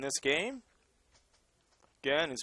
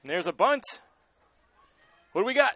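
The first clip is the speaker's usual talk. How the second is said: excited; 7 semitones higher, 7 dB louder than usual. Real, not acted. Exciting bunt play call.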